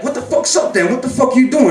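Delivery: imitating an agitated brother, high-pitched; retold challenge. Brother challenging him from the car, agitated.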